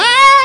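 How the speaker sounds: high loud mock child's scream. Preacher imitating a child screaming in the shop. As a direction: shriek like a tantruming kid.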